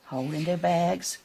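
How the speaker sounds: plain reading voice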